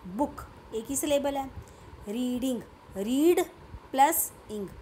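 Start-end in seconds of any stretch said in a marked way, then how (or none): 3.0-4.7 "रीड", then "इंग", each part set apart and louder; syllable breakdown. Shown as a demonstration. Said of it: "तो रीड इसमें एक syllable हो गया". ("रीड" और "इंग" को अलग-अलग, ज़ोर देकर बोलें)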